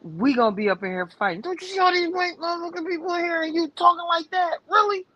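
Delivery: rising to a high, heated scold; mock outrage at a husband's slur; retold angry scolding. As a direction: scold him like you mean it, pitch climbing. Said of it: "I said".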